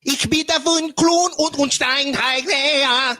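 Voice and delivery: high, drawn-out fake German; mock German gibberish. Bluffer trying a different language on visitors, mock German gibberish. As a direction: declaim pretend German, pitched high.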